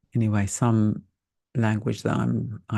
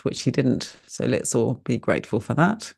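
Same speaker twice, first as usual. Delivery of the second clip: normal talking voice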